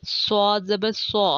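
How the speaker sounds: slow, heavy emphatic s in سو; distinct letter articulation. Shown as a demonstration. Teacher showing learners similar letters, slow heavy s. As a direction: draw out سو with a full, rounded s. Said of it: "ان کی ادائیگی بالکل".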